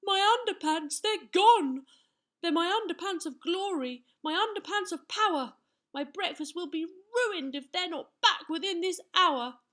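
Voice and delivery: high, loud kingly outcry of dismay; anguished cry. A narrator's reading. King cries over his missing underpants, anguished and loud. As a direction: wail it out loud and high, distraught. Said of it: "stared down at the floor and cried".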